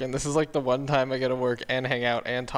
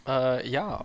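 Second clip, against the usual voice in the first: ordinary talk, nothing marked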